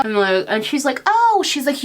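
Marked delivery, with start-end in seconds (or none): 1.1-1.4 high, loud surprised "Oh"; sudden recognition, higher and louder. Retold or imitated. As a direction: catch her off guard, pitched way up. Clerk recognizing caller, surprised.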